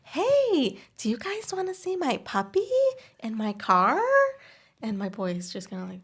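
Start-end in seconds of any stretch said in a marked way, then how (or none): 0.0-4.0 high, sing-out luring voice; pretend woman stranger. Mom posing as stranger with a puppy, high coaxing woman voice. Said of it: "I'll be like, another woman".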